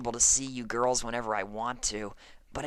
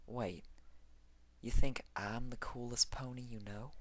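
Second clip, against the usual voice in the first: hushed surprise; 3 semitones lower, 8 dB quieter than usual. Acted. Surprised at being called coolest.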